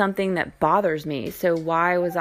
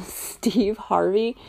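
usual speaking voice

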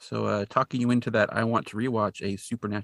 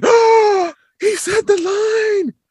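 very high, loud delighted squeal; squealing fan who got the payoff; gleeful squeal; 11 dB louder, much slower than usual. Retold or imitated. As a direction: shriek it with fanboy glee. Dean excited villain said line.

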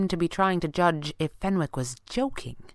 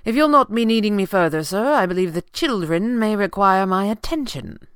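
louder, higher, formal butler; grim butler; 5 semitones higher, 6 dB louder than usual. A narrator's reading. Butler taking leave to deal with the children, grim. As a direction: excuse yourself firmly, on a mission.

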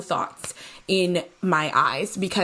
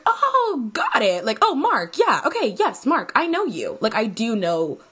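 high, overeager fake agreement; high, overeager mock relief; fake recognition; 4 semitones higher than usual. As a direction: gush like you remember him. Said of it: "And so I was like".

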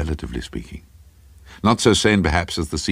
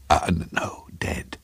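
halting, stammered whisper; hesitant whisper; 5 dB quieter than usual. A narrator's reading. Craven answering a stranger in the cinema, hesitant whisper. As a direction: whisper it reluctantly, stumbling on the word.

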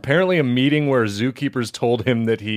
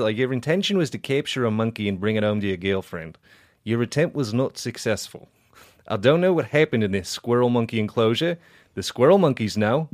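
Southern judge; 3 semitones lower than usual. Acted. Podcaster reading a judge's final statement to friends, Southern judge voice. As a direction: hand down the sentence as a Southern judge. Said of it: "just do Southern Judge".

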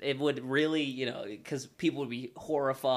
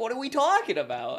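high, louder mock bewilderment; baffled quote; 11 semitones higher, 5 dB louder than usual. Guest retelling a friend's reaction, high baffled mock question. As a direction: act out his confused question, pitched up. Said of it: "He's just like".

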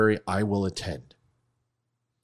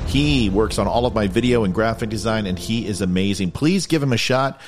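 louder, projected plug read; promotional sign-off; 6 dB louder than usual. Real, not acted. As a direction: deliver the show's closing plugs. Sign-off plugs over music.

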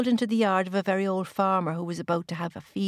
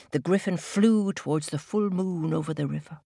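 ordinary delivery

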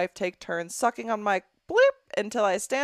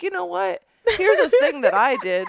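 mocking the letter writer; 9 semitones higher, 7 dB louder than usual. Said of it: "the thought to be like".

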